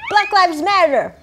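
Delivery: mock provocative sound bite. Guest giving the requested shocking last word, high slogan. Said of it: "one thing that'll make people go".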